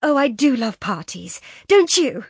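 high, loud, delighted gush; thrilled burst, climbing at the end; excited gasp. A narrator's reading. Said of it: "gasped Laura".

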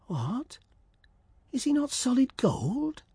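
whispered then high wondering; to himself. Acted. Swallow surprised at the prince, whispered aside. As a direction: whisper the first word, then wonder aloud. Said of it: "said the swallow to himself".

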